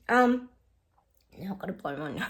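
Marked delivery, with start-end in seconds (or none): none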